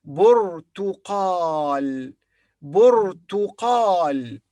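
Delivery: burtuqal very slowly, syllable by syllable; Arabic burtuqal. Shown as a demonstration. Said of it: "teacher models the word".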